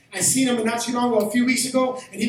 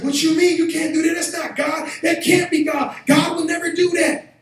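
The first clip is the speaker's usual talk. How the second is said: retold angry protest; 7 semitones higher than usual. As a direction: shout back that it can't be God. Friend protests being cut off.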